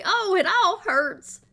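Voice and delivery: high, loud, slow whine; hammed-up teasing whimper; mock whiny catchphrase. Family teasing an upset kid, mock whine. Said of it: "we say".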